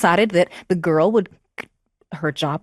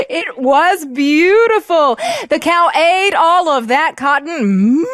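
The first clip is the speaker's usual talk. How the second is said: higher, louder, slow and marvelling; delighted wonder; 7 semitones higher, 6 dB louder, slower than usual. Acted. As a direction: savour the wonder. Marvelling at the thread.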